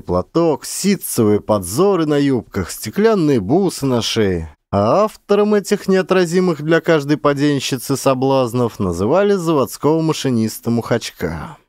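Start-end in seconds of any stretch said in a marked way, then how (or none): none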